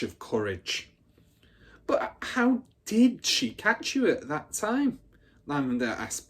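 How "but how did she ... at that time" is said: high and slow, hushed with awe; a wide-eyed child hanging on every word; breathless with wonder. A narrator's reading. Lavender asks the older girl, breathless with wonder. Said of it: "breathless with wonder".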